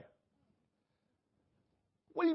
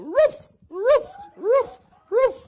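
high, loud yapping barks; barking like a dog; 13 semitones higher, 7 dB louder than usual. Retold or imitated. Preacher acting out the wife's barking for the church, high yaps. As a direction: bark like a hopping dog. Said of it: "while you bark like a dog".

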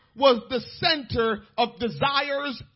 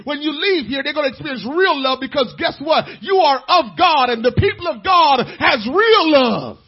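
full-force preaching; 3 semitones higher, 10 dB louder than usual. Acted. Pastor preaching obedience from love to the congregation, full-force. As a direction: drive it home to the pews.

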